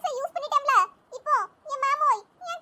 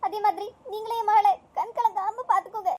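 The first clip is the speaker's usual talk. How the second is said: tearful mother; 6 semitones lower than usual. Acted. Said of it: "அழாதீங்க தே".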